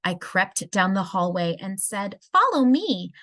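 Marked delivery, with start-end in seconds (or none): 2.3-3.1 urgent call. Acted. Calling out during fire.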